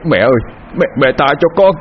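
loud frightened plea, rising; terrified cry. Acted. River god cries out to Mother begging mercy, terrified. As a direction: beg for life in panic. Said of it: "kinh hãi kêu lên".

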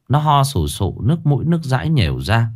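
plain reading voice

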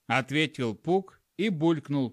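ordinary delivery, nothing marked

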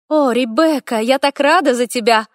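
bright, high gushing delight; delighted chatter. A narrator's reading. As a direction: gush over the happy news. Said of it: "восторженно щебетала".

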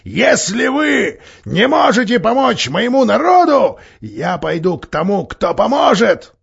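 breaking into a high loud cry; shrill shout. Acted. Dwarf threatening the council, shrill shout. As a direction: voice cracking upward into a yell. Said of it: "срываясь на пронзительный крик".

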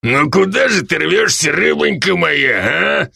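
put-on lecherous drunk teasing a waitress; drawn-out, taunting drunk voice; leering drunk. A narrator's reading. Drunk leers at struggling waitress. (пьяный похабный голос)

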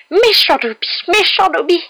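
shrieked very high and loud; frantic self-scolding elf shriek; screaming. A narrator's reading. Elf banging his head to punish himself, screaming. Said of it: "en criant".

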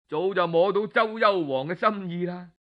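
ordinary delivery

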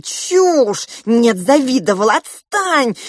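high, loud angry retorts; heated bickering. Acted. Parents quarrelling over daughter's beauty, angry and loud. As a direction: fling accusations back and forth.

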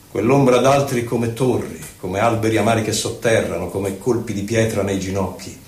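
normal talking voice

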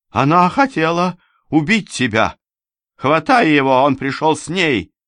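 high, loud cry of alarm; urgent yell. A narrator's reading. Rubinstein's lover rousing him to grab the intruder, urgent yell. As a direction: call out sharply for help to seize him. Character yells to seize the intruder.

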